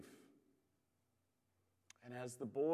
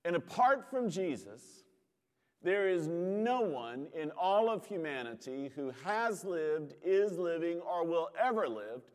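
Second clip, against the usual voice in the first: louder, driving preacher cadence; fervent preaching; 4 semitones higher, 6 dB louder than usual. Acted. Pastor stirs his congregation about God knowing grief, fervent preaching. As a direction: build it like a pulpit climax.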